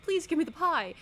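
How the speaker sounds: high, loud whiny demand for pie; pushy kid begging for the last pie; mock demanding plea. Demanding pie, cutting in line.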